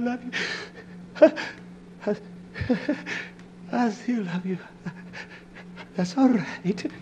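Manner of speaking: quiet, tender I love you; right take, soft and gentle; soft wife's voice. Shown as a demonstration. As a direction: say I love you softly and hurt. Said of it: "No, she said".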